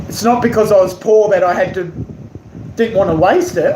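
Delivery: normal talking voice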